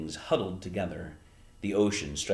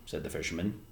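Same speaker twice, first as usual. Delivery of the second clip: usual speaking voice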